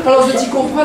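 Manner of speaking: ordinary delivery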